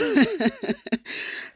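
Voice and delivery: short scornful laugh; a soft mocking chuckle. Real, not acted. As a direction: laugh it off in disbelief. Scoffing at parliament clip.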